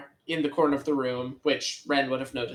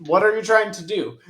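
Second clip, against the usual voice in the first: mock confused hero; 6 semitones higher, 5 dB louder than usual. [name] acts out confronting villain.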